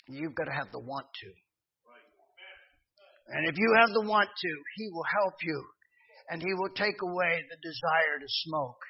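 normal talking voice

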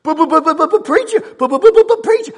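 high, loud sputtering stammer; mock objector. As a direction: sputter like a flustered objector butting in. Mimics objecting congregant interrupting preacher.

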